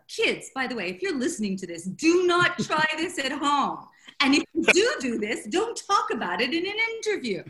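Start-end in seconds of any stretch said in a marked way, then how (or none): 1.8-7.4 mock warning. Real, not acted. Author warning kids listening at home, in mock-stern loud voice. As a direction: raise voice and pitch, warn with a laugh underneath.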